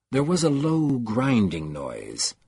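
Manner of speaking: plain reading voice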